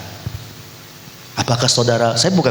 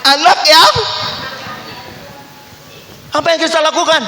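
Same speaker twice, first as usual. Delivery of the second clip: exasperated high cry; 14 semitones higher than usual. Acted. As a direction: cry out in disbelief. Preacher exclaims in mock outrage.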